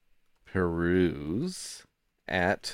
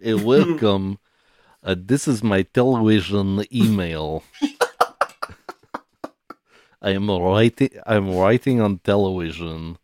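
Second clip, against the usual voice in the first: put-on Russian accent; 7 dB louder than usual. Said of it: "a Russian accent".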